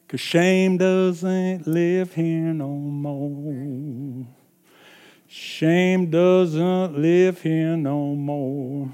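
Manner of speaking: drawn-out, deliberate preaching; slow proclamation. Real, not acted. Preacher declaring to the congregation, slow and deliberate. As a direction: let each word of it sink in slowly.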